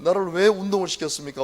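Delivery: a pastor complaining to God about wrestling; plaintive complaint. Retold or imitated. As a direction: demand why of God.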